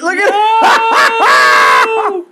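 slow, stretched, shouted; loud drawn-out shout. Real, not acted. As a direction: drag it out and yell it.

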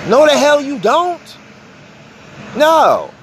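high, loud angry retort. Real, not acted. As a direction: snap back in outrage. Angry rebuttal to courts.

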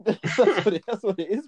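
high, laughing through the words. Real, not acted. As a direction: chuckle while saying it. Laughing after his own joke.

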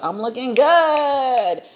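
slow, high sassy brag; sassy boast. Pretend. Telling viewers how a flawless look feels, slow sassy brag. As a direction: stretch it out, show off.

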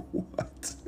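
breathless, stunned whisper. Real, not acted. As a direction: barely get it out in disbelief. Reacting to absurd meme text.

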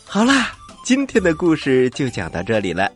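plain reading voice

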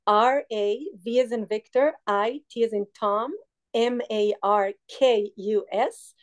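"R A V as in Victor, I, T as in Tom, M A R K U S" spelled letter by letter, slowing at the end; spelling it out. Shown as a demonstration. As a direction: spell out "M A R K U S" one letter at a time. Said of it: "give us the Instagram, uh, page one more time".

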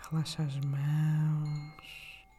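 calm guide with long pauses between breaths; guided meditation. Acted. (meditação guiada, voz suave e lenta)

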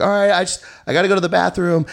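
stalling excuse. Retold or imitated. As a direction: make a flimsy excuse. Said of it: "I was like".

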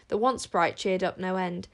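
ordinary delivery

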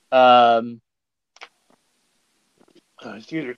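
talking between sips of water; while sipping a drink. Real, not acted. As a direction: mouth wet from a drink. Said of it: "I'm drinking a drink of water".

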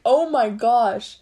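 retold groan at the phone ringing again; disbelieving oh my gosh. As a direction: drag it out in mock exasperation.

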